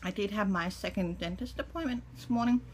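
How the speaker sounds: talking while chewing; speaking around a mouthful; mouth full. Real, not acted. Seen 1: Cheeks puffed, lips pursed mid-chew between phrases while holding food.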